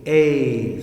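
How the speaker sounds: A said long like its name, ay; long A sound. Shown as a demonstration. Teacher modeling the long A, clear and firm. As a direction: say the letter A as a long ay. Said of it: "We read it like A".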